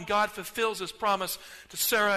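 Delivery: ordinary delivery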